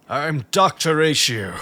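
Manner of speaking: loud, drawn-out character announcement; Doctor Ratio. Acted. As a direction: proclaim it slowly in a hammed-up impression. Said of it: "not really good with the impressions today".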